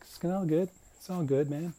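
dad telling Steve Jobs not to worry, high and breezy; imitating dad reassuring. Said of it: "it's like".

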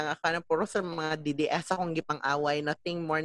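ordinary talk

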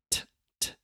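breathy popping t; aspirated T. Shown as a demonstration. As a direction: pop the t with air. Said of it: "going t".

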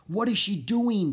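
high, frantic inner thought; alarmed, racing thought; panicked thought. A narrator's reading. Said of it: "Jack thought wildly".

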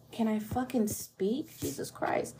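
annoyed at herself, under breath; muttered self-annoyance, half whispered; frustrated mutter. Real, not acted. Annoyed at tripping over words.

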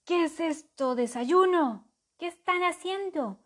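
high, loud startled questions; bewildered dragon. A narrator's reading.

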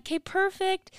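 high customer-service sign-off; retold polite wrap-up. Agent confirming payment, high polite retold wrap-up. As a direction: lift it into a pleasant phone voice.

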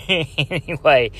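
slow, laughed-out word; laughing. Real, not acted. Podcaster amused by his own horrible accent, laughing. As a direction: chuckle into the word at your own expense.

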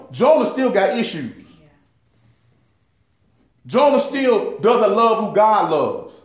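loud, high preacher's fervor; fiery sermon peak; preaching at full force. Real, not acted. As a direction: pour it out like a pulpit climax.

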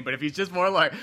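usual speaking voice

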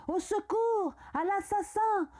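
high-pitched alarmed cries; cry for help. A narrator's reading. Mother screams for help against her son, high alarmed cry. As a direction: cry murder, pitch leaping up, volume held back. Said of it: "elle s'est mise à crier".

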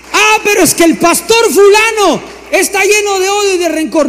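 high, loud whiny objection; mock excuse. Preacher mimicking a member blaming the pastor, high and whiny. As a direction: mimic a complaining churchgoer.

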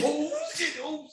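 high-pitched panicked yelp, retold; scared. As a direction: re-enact a skidding driver's panic. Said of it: "I was scared as shit".

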